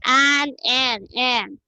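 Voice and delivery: "an" repeated with a clear American short A; careful pronunciation. Shown as a demonstration. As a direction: sound out "an" for the class, evenly spaced. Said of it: "make sure that you pronounce the word correctly".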